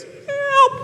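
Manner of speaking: very high tiny help; high squeak cry. Retold or imitated. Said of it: "you hear this".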